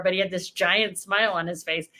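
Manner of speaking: ordinary delivery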